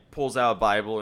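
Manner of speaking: ordinary talk, nothing marked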